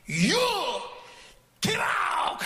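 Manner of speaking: umpire ejecting. Retold or imitated. Umpire shouting at the Korean coach, ejecting him. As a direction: throw him out with a yell. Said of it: "하는 거야".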